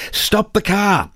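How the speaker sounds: sudden high-pitched alarmed cry. Retold or imitated. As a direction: yelp it out high and urgent. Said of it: "exclaimed in a high-pitched voice".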